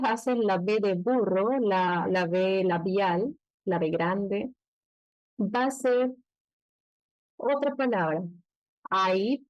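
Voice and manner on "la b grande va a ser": ordinary delivery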